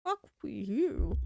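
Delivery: ordinary talk, nothing marked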